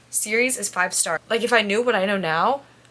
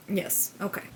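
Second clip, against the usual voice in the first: whispered aside; 8 dB quieter than usual. Real, not acted. Reader whispering to herself mid-chapter. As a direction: whisper it to yourself. Reacting while reading.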